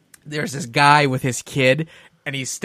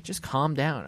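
calming down; 10 dB quieter, slower than usual. Retold or imitated. Partner soothing angry teller.

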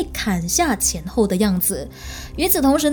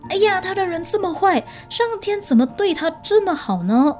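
indignant complaint; 8 semitones higher than usual. Retold or imitated. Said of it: "或者是说".

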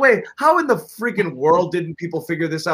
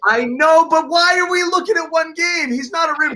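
raised, high exasperated protest; 11 semitones higher than usual. Real, not acted. Heated basketball argument.

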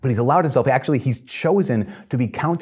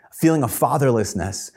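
ordinary talk

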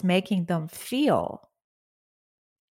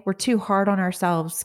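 usual speaking voice

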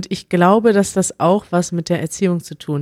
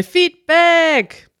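jingle call; 10 semitones higher than usual. Pretend. Host answers with his own jingle, high mock Feedback. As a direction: belt it out high like a cheesy show tag. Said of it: "da haben wir ja keinen Jingle für".

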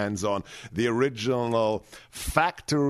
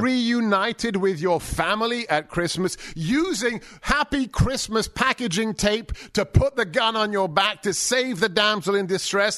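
higher, louder, building to a shout; passionate mock argument; 6 semitones higher, 4 dB louder than usual. Host insists Die Hard is a Christmas movie, in excited higher argument. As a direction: argue it like it's obvious.

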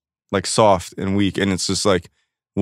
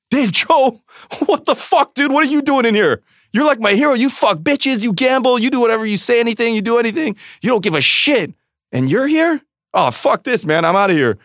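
much higher, hyped-up impression of a starstruck young guy; excited fan; 12 semitones higher than usual. Comedian imitating a Korean fan who spots him at a meeting, much higher hyped voice. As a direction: imitate the fan gushing over his hero, then bailing.

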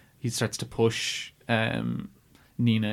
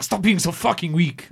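put-on raised, drawn-out yell in a poor French accent; higher, louder, slower hammed-up scolding; [name]'s mock scream; 7 semitones higher, 5 dB louder than usual. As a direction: imitate an exasperated French director yelling. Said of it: "he just screams at her".

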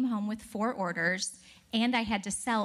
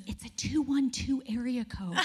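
excited whisper; 3 semitones higher than usual. Retold or imitated. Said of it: "she goes".

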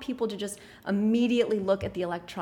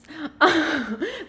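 embarrassed laugh; 7 semitones higher, 7 dB louder than usual. Real, not acted. No excuse for curtains.

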